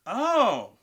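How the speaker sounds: high, loud drawn-out oh; surprised. Retold or imitated. Reacting to the odd character pick.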